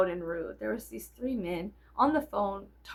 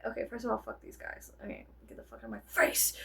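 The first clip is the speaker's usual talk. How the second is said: whispered; 8 dB quieter, faster than usual. Retold or imitated. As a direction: breathe it under your breath. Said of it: "I was like".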